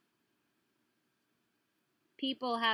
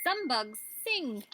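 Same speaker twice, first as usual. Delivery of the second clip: put-on reading to children; 3 semitones higher, 6 dB louder, slower than usual. Reading book aloud.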